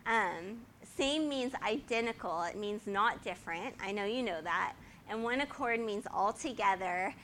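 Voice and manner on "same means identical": ordinary talk, nothing marked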